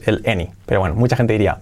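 ordinary talk, nothing marked

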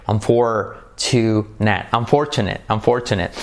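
Unfortunate with a strong R, slowly; Unfortunate, roll the R in for-. Shown as a demonstration. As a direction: stress the R in Unfortunate. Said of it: "con R".

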